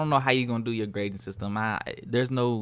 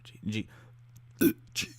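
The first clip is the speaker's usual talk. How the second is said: burped letters; burping the words; 6 dB quieter than usual. Shown as a demonstration. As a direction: burp out each letter. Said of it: "burping the alphabet".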